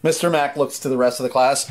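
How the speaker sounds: ordinary delivery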